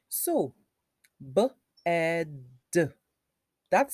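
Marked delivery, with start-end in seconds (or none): 1.3-2.4 "b-ed" in separate sounds; phonics-style "b-ed", sound by sound. Shown as a demonstration. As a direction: break "b-ed" into b, e, d. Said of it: "you take it in parts".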